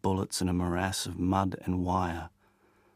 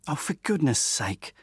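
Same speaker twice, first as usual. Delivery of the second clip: a mildly sharp, exasperated outburst; 7 semitones higher than usual. A narrator's reading. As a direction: snap it out with impatience.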